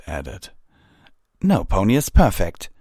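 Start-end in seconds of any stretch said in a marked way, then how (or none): 1.4-2.7 raised, defensive grumble; grousing. A narrator's reading. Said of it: "Pyre Valor groused".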